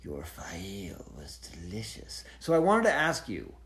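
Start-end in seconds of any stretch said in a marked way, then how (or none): 0.0-2.2 slow, menacing whisper; creepy monster whisper, low and hushed; sinister creature. Acted. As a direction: taunt in a soft, drawn-out whisper. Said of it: "then the Murdral comes in. He's like".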